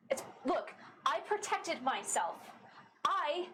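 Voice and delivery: runaway telling her crew she can protect herself; defiant Astra. Acted. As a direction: throw their warnings back at them.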